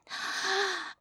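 high hammed-up gasp; mock gasp. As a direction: gasp like a bad phone-sex actor. Fake sexy phone gasp.

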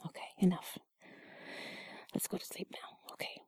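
drowsy settling whisper. Acted. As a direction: wind down to sleep softly. Held whisper.

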